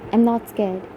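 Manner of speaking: usual speaking voice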